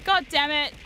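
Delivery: ordinary delivery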